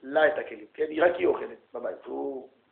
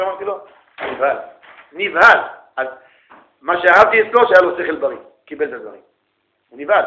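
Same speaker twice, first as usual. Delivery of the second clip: normal talking voice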